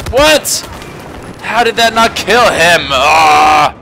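high, loud cry of disbelief; outraged disbelief. Real, not acted. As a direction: protest loudly, ending in a frustrated yell. Outraged that enemy survived.

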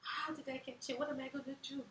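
high-pitched mock despair, no real sobs; imitated tearful lament. New mother crying to her midwife about clothes, tearfully fretting. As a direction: fret it out high, as if near tears. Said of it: "busted into another season of tears and cried".

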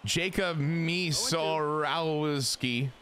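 very slow, stretched-out surname; drawn-out name. Real, not acted. Streamer introducing his pitcher, slow drawn-out name.

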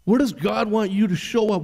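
ordinary talk, nothing marked

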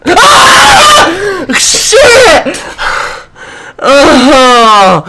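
high, loud shriek of real fright; startled scream. Real, not acted. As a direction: scream as you get caught. Killed in horror game.

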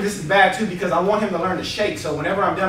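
normal talking voice

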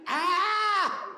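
panicked cry. Retold or imitated. Blinded boxer in the ring crying out in panic.

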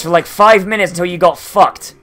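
ordinary talk, nothing marked

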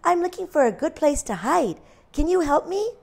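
high, small lamb voice; lightly voiced little lamb. A narrator's reading. Little lamb politely asking the cow for help. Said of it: "said Little Lamb".

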